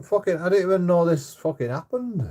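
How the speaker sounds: high, loud frustrated retort; exasperated outburst. Retold or imitated. Boyfriend snapping back at his girlfriend about her hand, exasperated. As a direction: fire it back at her, fed up. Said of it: "I'm like".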